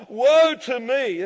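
loud, high, slow lament; booming, hammed-up wail from the pulpit; acted cry of woe. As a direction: stretch it out as a dramatic cry. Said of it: "I cried".

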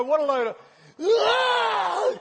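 scornful start bursting into a high, loud wail; sudden mock bawl. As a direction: break abruptly into hammed-up crying. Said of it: "it wasn't a build-up cry".

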